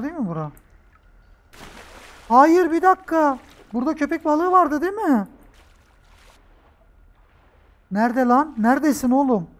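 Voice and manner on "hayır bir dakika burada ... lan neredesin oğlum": nervous high calling. Real, not acted. Searching for the shark.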